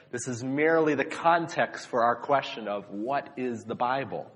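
ordinary delivery

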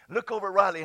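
usual speaking voice

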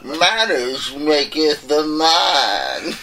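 very slow, drawn-out mock English quote; mock British accent. Tryout among friends: slow mock British accent. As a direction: savor each word like a movie gent. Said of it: "talk a little with a little British accent".

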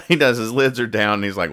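normal talking voice

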